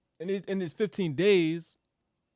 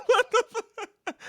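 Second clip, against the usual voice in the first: laughing disbelief; 7 dB louder, much slower than usual. Real, not acted. Incredulous at three-day offer.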